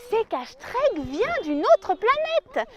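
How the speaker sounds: ordinary talk, nothing marked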